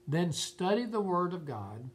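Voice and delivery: ordinary delivery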